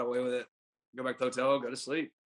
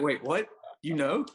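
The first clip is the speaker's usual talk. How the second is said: higher, startled disbelief; surprised; 5 semitones higher, 4 dB louder, slower than usual. Retold or imitated. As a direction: caught off guard, genuinely shocked. Shocked his catcher knows.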